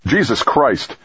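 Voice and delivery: high, startled exclamation; alarmed yelp. A narrator's reading. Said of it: "Dex's voice yelped behind him".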